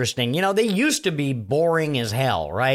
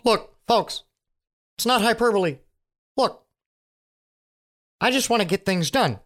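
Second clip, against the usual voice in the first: Biden impression; 7 semitones higher than usual. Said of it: "Biden's over here on one side going".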